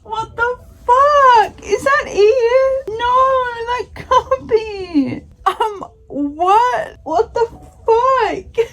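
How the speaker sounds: shocked and sobbing at once; crying disbelief. Real, not acted. Shocked by the book's ending.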